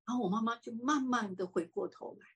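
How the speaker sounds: normal talking voice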